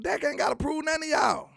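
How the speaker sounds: high, emphatic shout on none; heated shout. Real, not acted. Heated defense of Dak.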